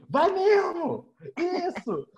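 very high, drawn-out cheering; egging on. Retold or imitated. Friend egging on the complaint, high mock cheering. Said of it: "você botando pilha".

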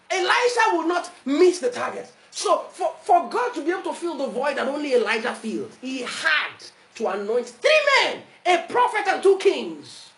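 high, loud, driving sermon; forceful pulpit declamation; preaching at full force. Acted. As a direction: preach it with mounting amazement.

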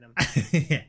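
slow, hissing snake voice, light touch; drawn-out s like a snake. Retold or imitated. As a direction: stretch every s like a snake. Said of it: "weird snake voice".

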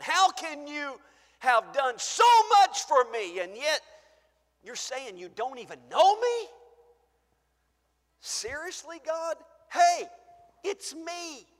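high, loud disbelief; can't believe God doesn't know you; hurt believer. Acted. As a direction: believer stunned at being rejected. Acted plea at judgment.

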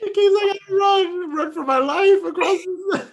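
high, laughing mock panic. Teasing friend about running for her life, laughing high. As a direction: squeal it through laughter. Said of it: "mocking her packing Red Bulls".

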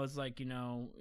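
usual speaking voice

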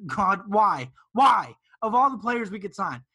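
loud exasperated cry of why; mad shout. Retold or imitated. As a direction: yell it out in angry disbelief. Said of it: "I got actually so mad".

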